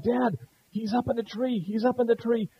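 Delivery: put-on child's alarm. Eldest son runs in alarmed.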